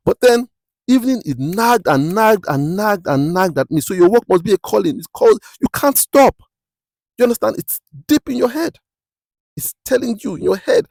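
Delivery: high, urgent preaching, drawing out the nagging; impassioned preaching. Real, not acted. Preacher tells listeners the calling nags at them, impassioned. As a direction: preach it hard, pitch climbing right up.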